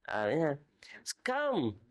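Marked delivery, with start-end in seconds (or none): 1.2-1.8 high, ringing call; joyful call. Retold or imitated. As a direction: lift it up like a glad invitation. Master invites servant to rejoice.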